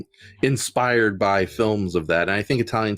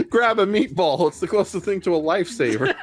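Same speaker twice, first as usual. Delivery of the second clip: put-on Italian accent; 9 semitones higher than usual. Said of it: "going about your Italian accents".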